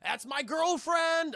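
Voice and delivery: long, high, loud mock wail; anguished holler. Kong watches her fall into the water, drawn-out anguished shout. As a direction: stretch it out in hammed-up despair.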